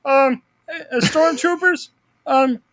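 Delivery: high, timid Kermit-like voice calling to the crew; George Lucas directing. Retold or imitated. George Lucas directing stormtroopers, high and meek. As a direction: politely fumble instructions to extras in a high little voice. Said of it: "I try to imagine him directing".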